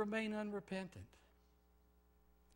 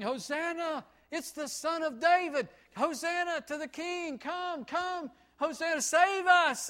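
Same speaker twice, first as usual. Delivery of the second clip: high, loud joyful hosanna cries; crowd acclaim; 10 semitones higher, 7 dB louder than usual. Acted. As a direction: hail the king like a cheering crowd. Crowd hailing Jesus, voiced.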